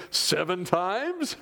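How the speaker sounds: mock pious. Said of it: "sounding extremely spiritual".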